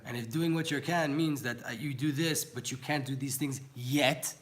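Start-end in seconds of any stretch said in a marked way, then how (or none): 2.4-4.3 slow down and lean on "yet"; "yet" drawn out and punched at the end; heavy emphasis on the final "yet"; stress on YET. Real, not acted. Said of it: "emphasis on the word yet".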